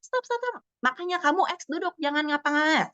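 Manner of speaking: high, squeezed mock scolding without much volume; scolding teacher. Teacher orders angry pupil to sit, imitated high-pitched scolding. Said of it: "biasanya guru-guru udah yang".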